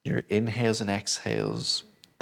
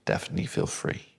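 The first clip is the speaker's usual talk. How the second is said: whispered; faster than usual. Acted. Soft permission in meditation.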